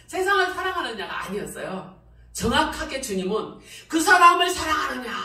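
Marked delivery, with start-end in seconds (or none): 3.9-5.1 loud, weighty voice of God asking; booming divine question, raised high; God's voice. Retold or imitated. Preacher voicing God's question with loud weight.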